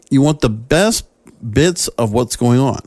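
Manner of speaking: ordinary delivery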